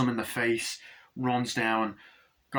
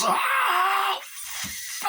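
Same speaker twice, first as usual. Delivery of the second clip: very high, drawn-out acted scream; hammed-up shriek of pain; agonised scream. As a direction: scream the curse as if being torn.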